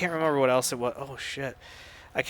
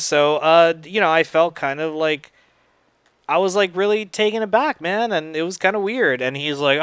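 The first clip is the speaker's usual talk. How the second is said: normal talking voice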